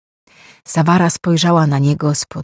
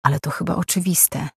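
much softer inner thought on "oczywiste"; thought aloud; 7 dB quieter than usual. A narrator's reading. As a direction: muse it under the breath. Lorkin's unspoken thought.

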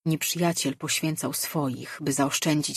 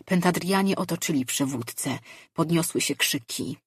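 usual speaking voice

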